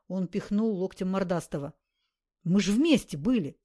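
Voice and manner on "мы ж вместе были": громкий возглас. Acted. Бандит хвастается.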